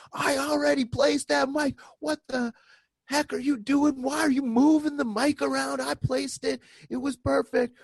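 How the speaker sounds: high, loud mock fury of an offended sound tech; furious yelling. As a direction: yell it like your setup was ruined. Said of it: "the sound tech just losing it on me".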